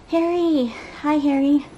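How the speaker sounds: ordinary talk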